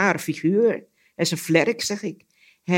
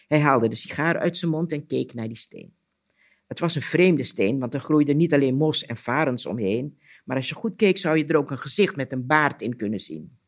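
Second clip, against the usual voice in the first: measured storyteller's reading voice; story narration; 3 semitones lower than usual. A narrator's reading. Storyteller reading an Anansi tale aloud, story narration. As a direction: tell it as a folk tale, pausing between sentences.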